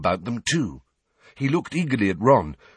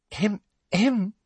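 high prim throat-clearing; a dainty fake little cough; 12 semitones higher than usual. A narrator's reading. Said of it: "Umbridge's fake cough".

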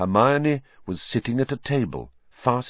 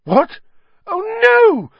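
high, loud squeak of dismay; a schoolgirl's shrill cry of dismay, much higher and louder; 8 dB louder, slower than usual. Acted. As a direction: let it jump up into a panicked squeak. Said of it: "Hermione squeaked".

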